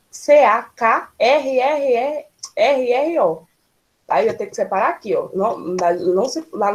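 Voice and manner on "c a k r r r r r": C A K R R R R spelled slowly, the R drawn out and repeated; spelled out letter by letter. Shown as a demonstration. Teacher spelling a word for the class, slow letter by letter.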